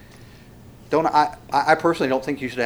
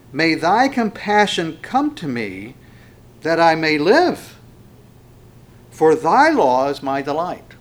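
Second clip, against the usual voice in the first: loud, slow congregational reading; scripture reading; 4 semitones higher, 7 dB louder, slower than usual. Acted. Preacher leading congregation in a psalm, loud scripture reading. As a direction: declaim it slowly for everyone to join in.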